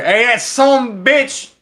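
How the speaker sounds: high, slow drawn-out yell. Acted. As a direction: yell it at her, loud and stretched. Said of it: "I just yell at her".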